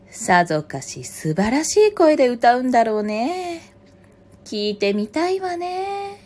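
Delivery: lilting praise climbing high at the end; flattering mother fox. A narrator's reading. Mother fox luring the crow to sing, flattering.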